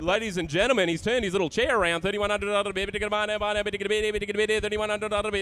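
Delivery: rapid rolling auction call; auctioneer calling. Acted. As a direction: rattle off the bids fast. Live auction chant.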